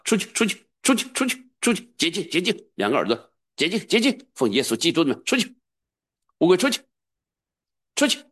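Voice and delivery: ringing high-pitched order of deliverance; commanding exorcism. Shown as a demonstration. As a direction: cast the demon out, preaching at full force. Demonstrates exorcism at full force.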